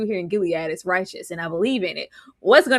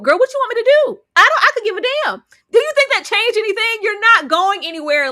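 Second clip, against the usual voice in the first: high, loud, fast, fed up; exasperated outburst; 11 semitones higher, 6 dB louder than usual. Acted. Friend snapping at Daisy, loud and fed up. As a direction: blow up in disbelief.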